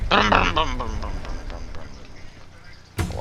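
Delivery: low droning brum brum like a propeller engine; engine noise imitation. Playing with the plane, mock engine brums. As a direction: hum an engine revving and fading off.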